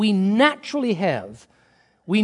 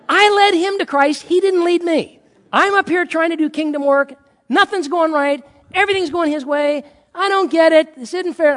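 loud, high-pitched mock rant; hammed-up aggrieved protest, voice raised; indignant complaint; 8 semitones higher, 5 dB louder than usual. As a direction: argue your case in exasperation. Acting out his complaint to God.

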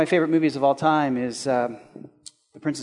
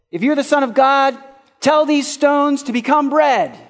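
high, louder tempter's voice; devil tempting; 8 semitones higher, 5 dB louder than usual. Acted.

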